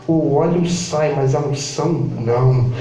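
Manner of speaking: low, slow divine pronouncement; voice of God. Acted. God answers the doubting preacher about the oil, low voice of God. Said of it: "E Deus falou assim".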